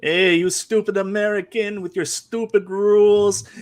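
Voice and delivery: bad French-Canadian accent. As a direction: taunt them in a hammed-up Quebec French accent. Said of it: "your interpretation of a French accent".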